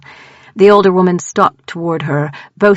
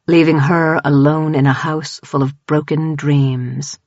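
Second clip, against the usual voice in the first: usual speaking voice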